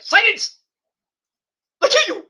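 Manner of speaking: high, loud, barked mock threat; hammed-up villain yelling silence; mock wrestler shout. Wrestler threatening the crowd in a high, hammed-up yell. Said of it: "he'll be like".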